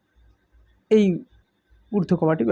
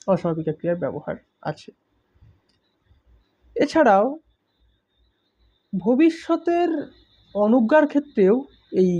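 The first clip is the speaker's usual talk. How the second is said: normal talking voice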